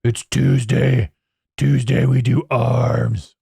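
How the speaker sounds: slow, drawn-out gym meathead voice; dopey, stretched-out lifter bragging; mock gym bro. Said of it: "You're like".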